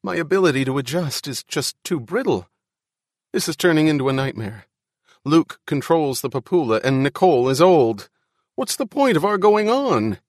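high-pitched, rising distress; anxious pleading. Acted. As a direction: plead as your nerve gives way, voice climbing. Ian despairs, pleading with Al.

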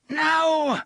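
shouting. A narrator's reading. Gasher refuses.

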